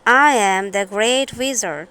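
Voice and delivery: ordinary delivery, nothing marked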